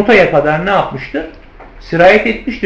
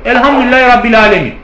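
teacher's correction; 4 semitones higher, 5 dB louder, faster than usual. Retold or imitated. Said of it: "İbn Kesir dedi ki yok".

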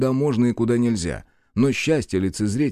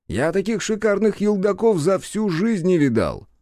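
mock admiration; 10 semitones higher than usual. Drunk-ish elder praising paintings.

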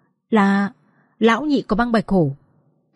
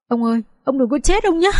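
tearful plea; 4 semitones higher than usual. Acted. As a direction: voice rising into a childlike wail. Frightened girl begs grandfather not die.

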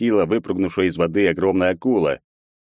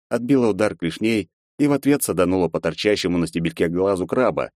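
plain reading voice

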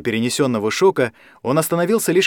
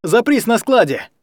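urgent raised command; 8 semitones higher than usual. A narrator's reading. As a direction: bark the order. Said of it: "скомандовал в микрофон Алекс".

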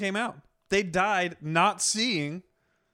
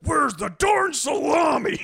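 slow, loud, higher angry shout; angry cartoon yell; 8 semitones higher, 5 dB louder, slower than usual. Imitating Wolverine yelling into the fridge at salami. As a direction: bellow it like an angry cartoon hero. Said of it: "He goes".

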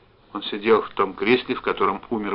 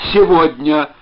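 loud, high exclamation; urgent raised cry; shouting; 6 semitones higher, 10 dB louder, slower than usual. A narrator's reading. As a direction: shout it down a bad line. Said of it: "закричал он".

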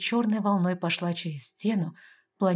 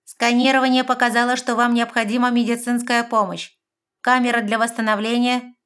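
high, loud machine voice; electronic voice; 5 semitones higher, 7 dB louder than usual. A narrator's reading. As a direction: announce it like a computer system. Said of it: "электронный голос произнёс".

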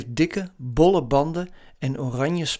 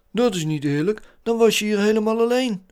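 hoger, luid oplopend medeleven; meelevende Zeus; 7 semitones higher, 5 dB louder than usual. A narrator's reading. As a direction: leef verontwaardigd mee als vriendje. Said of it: "zuchtte Zeus". (hoge personagestem)

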